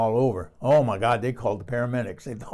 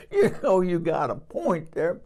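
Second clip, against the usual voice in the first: high, loud reply between laughs; laughing, raised-pitch agreement; amused concession; 7 semitones higher, 5 dB louder than usual. Retold or imitated. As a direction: concede the joke while still tickled. Her retold reply amid laughter.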